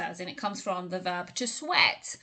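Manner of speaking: usual speaking voice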